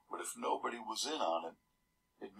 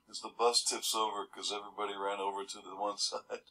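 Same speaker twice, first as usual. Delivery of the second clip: ordinary delivery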